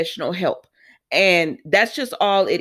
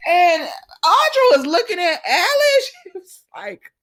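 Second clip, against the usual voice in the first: laughing through the words; amused laughing narration; 8 semitones higher, 3 dB louder than usual. Real, not acted. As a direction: crack up while telling it. Laughing while retelling.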